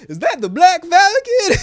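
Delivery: loud, very high-pitched cry; mock excited onlooker. Man in crowd spots the new hero, mock excitement. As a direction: shout it like an amazed bystander, hammed-up. Imitates onlooker in cheesy scene.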